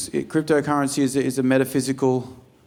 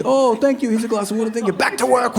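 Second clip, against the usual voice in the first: high, lightly voiced grateful labourer; mock grateful worker; 9 semitones higher than usual. Comedian voicing a coffee farm worker, mock gratitude. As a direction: overdo the gratitude.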